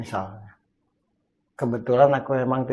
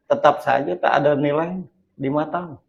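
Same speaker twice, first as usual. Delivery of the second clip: normal talking voice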